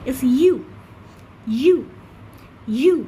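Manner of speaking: "you" said slowly and spaced, like the word you; letter U said as "you"; drill like "you". Shown as a demonstration. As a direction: repeat "you" for the class to copy. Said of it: "teacher models U like you".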